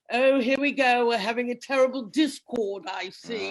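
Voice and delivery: mock the reserved British husband; a prim, fussy English gent; posh English husband. Mocking her English husband's reserve.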